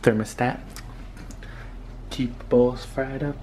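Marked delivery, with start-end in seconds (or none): none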